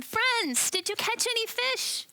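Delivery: calling out across the water, high and loud; yelled call. Acted. Stranger on shore shouting out to the fishermen in the boat, loud high call. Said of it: "he yells out at them".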